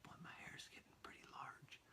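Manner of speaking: a private whisper to himself; muttered to yourself about your hair; whispered aside. Real, not acted. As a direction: breathe it with no voice.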